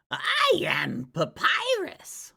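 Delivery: loud, high, drawn-out proclamation; Papyrus. Acted. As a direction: shout it grandly as a cartoon character.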